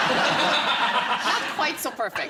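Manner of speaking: slow, laughing through the words. Real, not acted. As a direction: giggle out the punchline. Joking about sister after wine.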